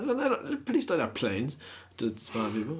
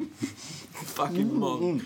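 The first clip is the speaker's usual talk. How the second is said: laughing; 6 semitones higher, much slower than usual. Real, not acted. Mocking a stuffed friend, slow.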